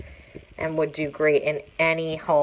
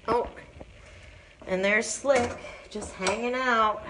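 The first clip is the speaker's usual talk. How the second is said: normal talking voice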